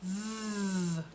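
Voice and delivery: V as a long buzzing vvv; v sound again. Shown as a demonstration. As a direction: hold the buzz on V. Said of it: "my tongue is between my teeth".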